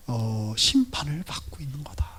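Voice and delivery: hushed whisper, pitch dropped low. Real, not acted. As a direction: let the verdict fall in a whisper. Solemn point about judgment.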